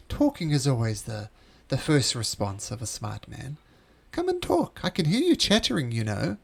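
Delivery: coaxing stranger, pitch leaping up then dropping; beckoning unseen voice. Acted. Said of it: "You hear coming from inside this room".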